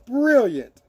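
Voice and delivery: Brilliant said through a laugh. Shown as a demonstration. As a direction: let the laugh spill into Brilliant. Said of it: "we say brilliant".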